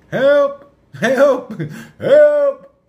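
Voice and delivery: loud, high, desperate cries for help; screaming for help. Retold or imitated. Retelling the crash to viewers, he recreates his loud, desperate screams for help. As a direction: belt out each help as if lying hurt alone on the road.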